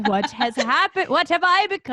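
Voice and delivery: loud, theatrical despair; mock horror. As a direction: cry out in hammed-up dismay over yourself. Mock horror at own drinking habit.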